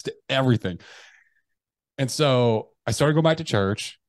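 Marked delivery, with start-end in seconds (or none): none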